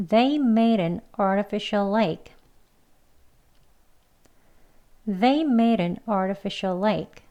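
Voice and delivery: slow, evenly paced model sentence; slow practice reading. Shown as a demonstration. Teacher repeats along with learners, slow practice reading. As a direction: say it slowly so learners can join in. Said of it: "두 번 같이 해보도록 하겠습니다".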